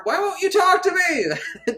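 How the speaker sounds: sudden high-pitched hammed-up wail; mock outburst. Ignored woman breaks off her musing, high mock-desperate outburst. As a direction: burst out high, imitating desperation.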